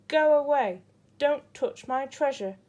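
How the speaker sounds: loud, angry snarl; grumpy lobster guarding his coins, loud; growled. A narrator's reading. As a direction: bark at the intruder to back off. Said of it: "the Lobster growled".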